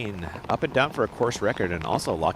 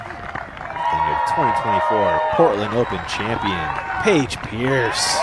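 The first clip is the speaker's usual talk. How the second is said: champion announcement; 2 semitones higher, 7 dB louder, slower than usual. Real, not acted.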